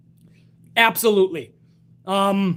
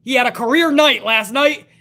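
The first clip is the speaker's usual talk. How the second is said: normal talking voice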